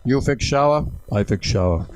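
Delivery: blunt mock-Polish broken English; Polish plumber accent. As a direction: put on a rough Polish accent. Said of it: "used a Polish plumber 'cause I can't do the accent".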